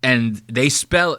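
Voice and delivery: usual speaking voice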